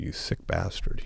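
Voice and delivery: slow, breathless whispered jab at himself; whispered insult. Retold or imitated. Scolding his mirror image in a slow whisper. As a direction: hiss it at your own reflection. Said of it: "I said".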